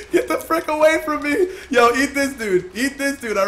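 high-pitched frantic plea; laughing panic. Real, not acted. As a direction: shoo the shark off, laughing. Shark chasing him.

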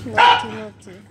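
high sing-song scold to the dog; mock-stern pet talk; playful scolding. Real, not acted. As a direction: tease the puppy for misbehaving.

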